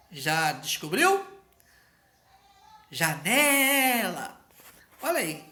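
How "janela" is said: "Janela" said very slowly, syllable by syllable, with the stress on "ne"; syllables stretched out. Shown as a demonstration. Teacher spelling out the stressed syllable for pupils, very slow and deliberate. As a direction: draw out "Janela" slowly and lean on "ne". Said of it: "Qual é a sílaba mais forte?".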